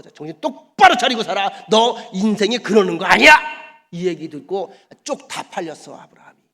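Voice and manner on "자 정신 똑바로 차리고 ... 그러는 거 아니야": loud rebuke from the king; loud scolding from a king. Acted. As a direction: scold him hard, louder.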